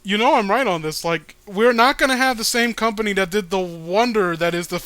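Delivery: ordinary talk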